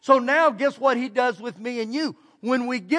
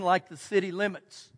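usual speaking voice

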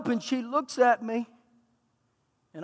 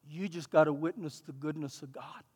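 soft, much quieter reassurance; gentle reassurance; 6 semitones lower, 12 dB quieter than usual. Retold or imitated. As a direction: comfort her with good news. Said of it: "And I said".

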